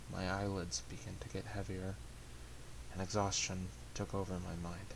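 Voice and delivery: soft, subdued first-person narration; hushed, even storytelling winding down toward sleep; story narration. A narrator's reading. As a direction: read it as a quiet inner monologue.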